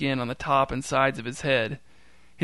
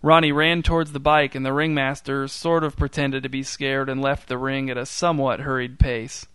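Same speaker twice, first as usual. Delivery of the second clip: ordinary delivery, nothing marked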